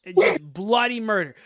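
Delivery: ordinary talk, nothing marked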